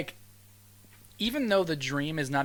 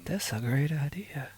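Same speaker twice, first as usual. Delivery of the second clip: whisper; slower than usual. Pretend. Slow whisper.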